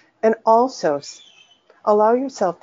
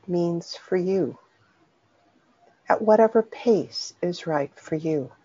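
unhurried meditation voice with long pauses; guided meditation. Acted. Teacher leading listeners through a soothing body scan, guided meditation. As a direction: guide them gently inward, phrase by phrase, leaving long silences.

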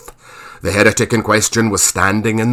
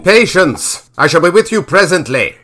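higher call back to friends; impatient call back; 7 semitones higher, 4 dB louder than usual. A narrator's reading. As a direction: call back over the shoulder. Calling back to companions.

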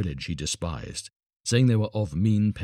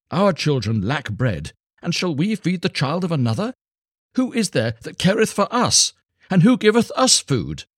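rising, louder bitter complaint, higher at the end; angry wife; 10 semitones higher, 6 dB louder than usual. A narrator's reading. Wife angrily rebuking her husband for another's child, rising. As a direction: scold him, building in pitch and force. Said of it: "spoke angrily".

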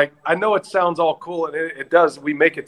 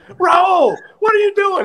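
a much higher, shouted startled manager yelling at the driver; mock angry yell; 14 semitones higher than usual. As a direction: bark it like a jolted-awake boss. Manager woken by sudden braking.

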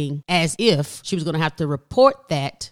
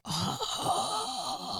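pained cry; 13 dB quieter than usual. Real, not acted. Painful memory, cry heard.

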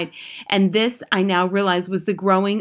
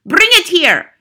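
high, loud witch's shout; shouted command; 10 semitones higher, 9 dB louder than usual. A narrator's reading. Grand High Witch summons her captive, shouted command. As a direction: shout it as an imperious order. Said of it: "shouted the Grand High Witch".

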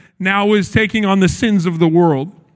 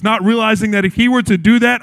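usual speaking voice

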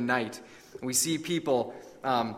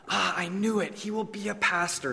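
lightly voiced motherly delight, pitched up; 2 semitones higher, 5 dB quieter than usual. A narrator's reading. Mother sure her son will be a pastor, softly delighted.

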